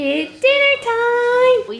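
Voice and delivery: high, drawn-out dinner call; playful singsong announcement. Pretend. Partner announces his tea, high playful singsong. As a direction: call it slowly in a silly high voice.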